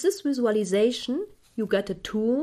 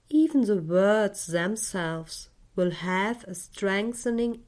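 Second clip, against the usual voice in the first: guided meditation. Acted. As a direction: guide the listener gently into calm.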